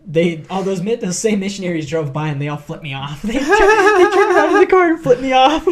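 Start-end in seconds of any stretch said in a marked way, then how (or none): 3.3-5.7 high, loud, cracking up; laughing hard. Real, not acted. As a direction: tell it through helpless laughter. Cracks up telling the story.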